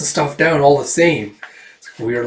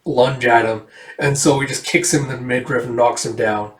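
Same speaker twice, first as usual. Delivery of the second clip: usual speaking voice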